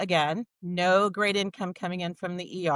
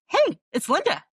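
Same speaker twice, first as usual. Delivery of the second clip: bright, much higher and louder mock-friend voice; a hint of the cheerful best friend; friend's cheery greeting; 11 semitones higher, 4 dB louder than usual. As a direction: chirp it like the friend bursting in. Friend quoted waking her up.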